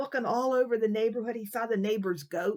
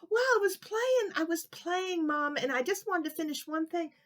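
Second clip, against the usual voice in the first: put-on higher, kid-like pleading voice; little boy Kevin; 8 semitones higher than usual. Boy Kevin explaining to Mommy why he's late, higher kid voice. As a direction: play a small boy making excuses.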